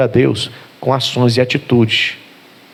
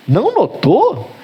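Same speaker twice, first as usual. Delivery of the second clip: high, slow, drawn-out disbelief; stretched, rising "not noticed?"; surprised question; 8 semitones higher, slower than usual. Retold or imitated. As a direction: let it hang, unbelieving. Young man asks, surprised, retold.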